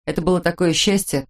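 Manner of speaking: ordinary delivery, nothing marked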